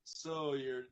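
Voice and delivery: normal talking voice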